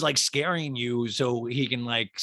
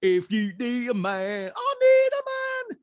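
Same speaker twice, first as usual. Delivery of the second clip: high silly P K impression; 9 semitones higher than usual. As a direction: ham it up as a crooning man. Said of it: "P K's like".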